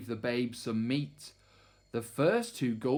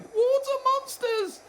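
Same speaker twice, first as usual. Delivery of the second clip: very high, slow, loud scream; terrified shriek; 6 dB louder than usual. A narrator's reading. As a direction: shriek in panic. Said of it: "one cried".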